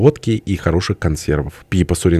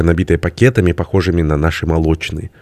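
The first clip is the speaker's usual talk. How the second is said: plain reading voice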